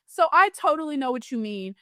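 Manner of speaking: normal talking voice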